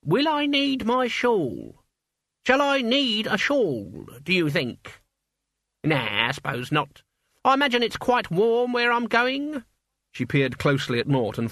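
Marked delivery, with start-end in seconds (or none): 0.0-9.6 put-on high, crackly old woman; old witch voice. A narrator's reading. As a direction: play an elderly witch, brisk. Witch voice in narration.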